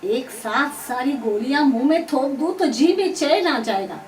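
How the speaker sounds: exasperated mother yelling; loud, fed-up shout; angry screaming. A narrator's reading. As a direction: yell it loudly to get rid of a pestering child. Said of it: "चीखते चिल्लाते हुए कहा".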